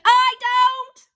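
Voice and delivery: high, loud yell; shouted refusal. Acted. Boy refusing to marry Andrea, loud. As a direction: refuse at full voice.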